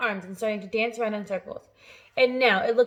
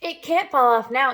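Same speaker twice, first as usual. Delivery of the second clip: louder shout; 3 semitones higher, 7 dB louder than usual. A narrator's reading. Aunt shouts that the peach is safe now, louder. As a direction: call out with relief. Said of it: "Aunt Sponge shouted".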